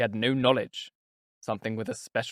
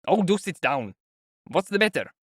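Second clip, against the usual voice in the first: higher, insistent host; raised, mildly exasperated concern; fussy urging; 6 semitones higher, 3 dB louder than usual. A narrator's reading. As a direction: press a guest to sit, fussing. Host urging a guest to sit.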